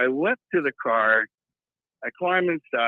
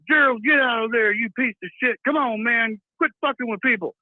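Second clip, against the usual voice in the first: higher, lightly voiced clerk banging on a door; 9 semitones higher than usual. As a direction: shout at Gerald through the door, half-hammed. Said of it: "He's like".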